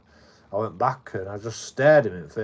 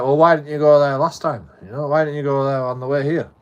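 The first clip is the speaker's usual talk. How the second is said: loud, raised-pitch imitation of an interrogator; confrontational questioning; 5 semitones higher, 9 dB louder than usual. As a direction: re-enact him demanding an explanation. Aggressor's retold interrogation.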